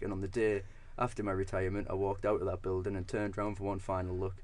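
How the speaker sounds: ordinary talk, nothing marked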